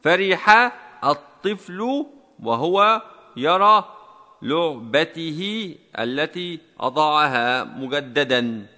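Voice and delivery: very slow, syllable-by-syllable dictation; dictation reading. Shown as a demonstration. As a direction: dictate slowly for students typing along. Dictating the second exercise sentence.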